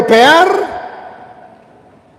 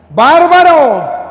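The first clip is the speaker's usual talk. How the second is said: long, high cry of "bárbaro"; exclaimed reproach; 4 semitones higher, 4 dB louder, much slower than usual. Real, not acted. Priest reacts to preaching that skips scripture, exclaimed reproach. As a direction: stretch the word right out, raised and emphatic. Exclaims at those avoiding the word.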